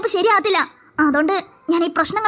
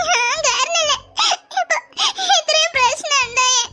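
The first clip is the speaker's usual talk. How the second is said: crying; 6 semitones higher, slower than usual. Acted. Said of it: "നീ എന്തിനാ കരയുന്നേ".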